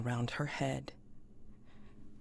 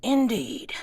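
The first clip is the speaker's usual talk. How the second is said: character voice; 10 semitones higher, 10 dB louder than usual. Acted. GM voices MacCready, then repeats plainly.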